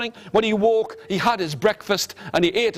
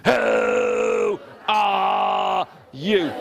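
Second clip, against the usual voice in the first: drawn-out booming dragon; slow, menacing dragon; much slower than usual. Acted. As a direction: stretch it like a huge dragon. Dragon voiced again, slowly.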